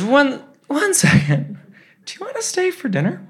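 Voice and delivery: very high, mock-flustered dad; flattered invitation. Dad loving the gushing invites fan to dinner, high and flustered. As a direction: squeak out the invitation, starting haltingly.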